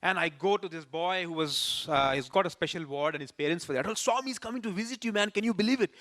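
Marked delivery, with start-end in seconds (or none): 4.0-5.9 quick, higher, eager announcement; telling the sick boy Swami is coming, quick and thrilled; excited news. Retold or imitated. Bursting with good news, fast and high.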